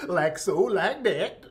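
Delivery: sing-song Southern drawl on "like so, like that"; mock Southern accent. Streamer parodying a cooking influencer's catchphrase, exaggerated Southern accent. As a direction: perform the catchphrase with a thick Southern lilt. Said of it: "she sounds like Mammy, our maid".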